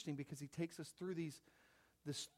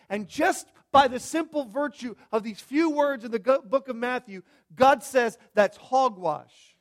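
preaching at full force; 6 semitones higher, 13 dB louder than usual. Acted. Preacher pressing the congregation about life's obstacles, loud and building. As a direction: build it like a pulpit climax.

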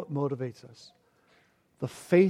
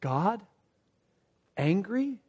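ordinary talk, nothing marked

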